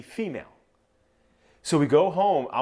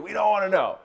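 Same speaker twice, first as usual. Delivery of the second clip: pitched-up, insistent retold announcement; emphatic announcement; 8 semitones higher, 4 dB louder than usual. Expectant father telling scan staff not to reveal it, emphatic. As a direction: tell everyone firmly in a raised voice.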